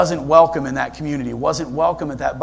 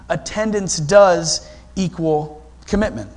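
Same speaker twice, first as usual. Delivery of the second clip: "does" drawn out and leaned on, slowly; preacher driving the point home, slow stress on "does"; 2 semitones higher, slower than usual. Real, not acted. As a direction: spell out "does" deliberately. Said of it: "repeating the corrected slogan".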